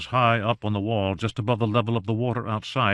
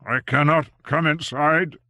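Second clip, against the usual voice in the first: cried out; 5 semitones higher, 5 dB louder than usual. A narrator's reading. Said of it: "cried Strange".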